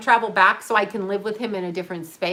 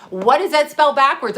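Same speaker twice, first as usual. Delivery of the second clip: "What does that spell backwards?" again, louder and higher, with "spell" punched; emphatic repeat; 5 semitones higher, 6 dB louder than usual. Retold or imitated. Retelling the guy's riddle to her viewers, louder and insistent. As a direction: push the question harder the second time.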